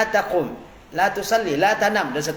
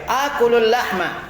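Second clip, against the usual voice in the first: model reading; 4 semitones higher than usual. Shown as a demonstration. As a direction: read it out for the class to copy. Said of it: "kita baca dulu ya". (bacaan contoh yang jelas)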